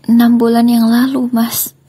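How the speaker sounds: high, loud desperate begging wife; pleading ex-wife. Acted. Ex-wife begs husband at police station, high desperate pleading. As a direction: beg him to free you, voice pitched high.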